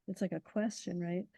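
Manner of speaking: ordinary talk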